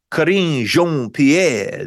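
drawn-out mock French pronunciation; French accent. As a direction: say the name slowly in a French accent. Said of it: "She didn't say it with the French accent".

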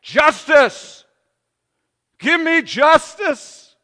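loud, high, slow anguished cry; crying out for justice. Acted. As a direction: voice the blood crying from the ground. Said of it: "Cries out from the ground".

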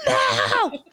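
high, drawn-out mock scream; imitated kid yell, no real volume; screaming child. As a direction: mimic a four-year-old's screamed refusal. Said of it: "He just kept screaming".

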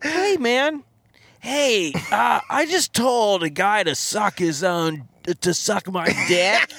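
high, eager voice, laughing; dead man telling Saint Peter, high and eager; excited newcomer. Pretend. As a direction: gush eagerly at the pearly gates.

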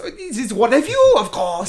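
high, loud mock French accent; Yvette, awful French accent. Imitating the maid answering the guests, hammed-up French accent. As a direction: accuse them in a bad French accent. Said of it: "in this awful French accent".

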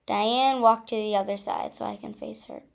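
usual speaking voice

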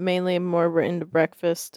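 usual speaking voice

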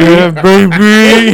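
slow, laughing Austin Powers drawl; Austin Powers catchphrase. Pretend. Mate hamming up the yob line on the podcast, slow and laughing. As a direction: stretch out the baby like Austin Powers. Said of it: "Why are you going into Rocky?".

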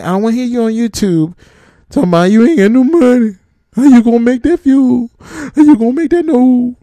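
scolding rant. Real, not acted. Scolding truckers who did not save.

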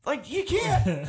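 high, drawn-out disbelief; retold incredulous protest. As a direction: balk at it in a high, slow voice. Retelling disbelief at the foreman's news.

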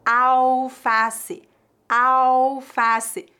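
Alface with no L, awfasi; clear, careful Alface, L as U; pronunciation demo. Shown as a demonstration. Teacher modelling Alface for learners, clear and careful. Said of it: "atenção à pronúncia".

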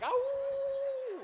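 high, long cartoon wolf howl. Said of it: "it's like Howling at the Moon".

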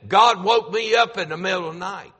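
mock-grumble about being woken; mock mad complaint. Caller pretending to be mad at God waking him, mock grumbling. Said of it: "he acted like he was mad about it".

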